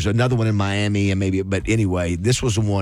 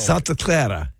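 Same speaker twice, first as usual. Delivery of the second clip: put-on Cuban accent; 3 semitones higher, slower than usual. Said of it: "you didn't do an accent this whole time".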